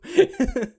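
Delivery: a loud, high burst of laughter. Real, not acted. Streamer cracking up at his own rant, loud high laugh. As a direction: crack up at your own joke.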